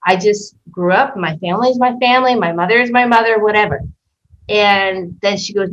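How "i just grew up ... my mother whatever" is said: normal talking voice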